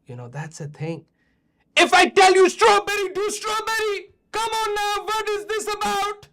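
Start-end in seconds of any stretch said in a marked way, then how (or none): 1.8-6.3 high-pitched mock scolding, loud at first; hammed-up angry rant at the kids; mock angry old man. Scolding the kids over strawberries, hammed-up anger. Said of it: "sorry, I should not".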